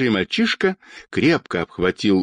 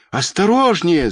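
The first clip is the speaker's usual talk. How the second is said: high-pitched warning call; warning cry; 7 semitones higher than usual. A narrator's reading. Crowd watching the old woman descend, warning cry. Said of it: "закричала толпа".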